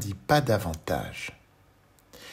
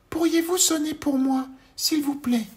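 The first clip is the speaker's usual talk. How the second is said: very high, louder little voice; tiny Monsieur Petit; 5 dB louder than usual. Acted. Tiny man greets the worm, very high voice.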